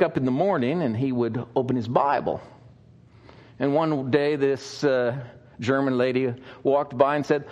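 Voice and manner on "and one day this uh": ordinary talk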